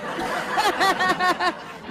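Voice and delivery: short burst of laughter; amused laugh. Real, not acted. Vlogger laughs at a photo on his phone, amused.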